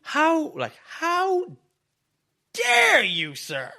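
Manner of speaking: very high, building to a loud peak; theatrically scandalised, stretched out with pauses; mock outrage. Cornerman affronted by his wrestler, hammed-up indignation.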